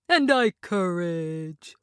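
slow timid lion; cowardly lion voice. A narrator's reading.

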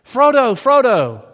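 calling out high and loud; a mock yell across the river; retold yelling. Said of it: "And he starts yelling".

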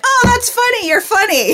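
very high, loud, gushing imitation; mock delayed delight. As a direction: act out finally getting the joke. Retold delayed reaction of whole group.

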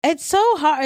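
ordinary talk

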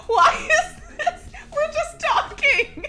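talking through helpless giggles; high, breaking up with laughter; laughing. Real, not acted. Giggling fit during trailer take.

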